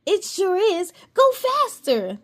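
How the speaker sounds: high, small, eager voice; tiny fairy voice. A narrator's reading. Cassie cheering Aria on, tiny and eager. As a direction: urge her on in a tiny voice. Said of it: "a tiny voice agreed".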